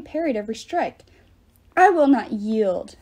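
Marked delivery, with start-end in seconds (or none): 1.8-3.0 loud, slow, booming defiance; giant's roar. A narrator's reading. Giant roaring defiance at Percy and Jason, loud and slow. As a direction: bellow each word at the demigods. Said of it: "Ephialtes roared".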